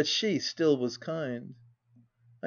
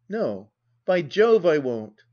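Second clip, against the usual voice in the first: refusing outright; 6 dB louder, slower than usual. Acted. Husband refuses wife angrily.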